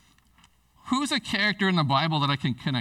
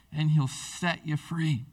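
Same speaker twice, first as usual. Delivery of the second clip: normal talking voice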